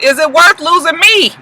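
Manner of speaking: high, incredulous echo of her question; mocking boyfriend retort. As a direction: throw her question back, mock-outraged. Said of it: "And he was just like".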